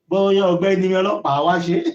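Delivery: normal talking voice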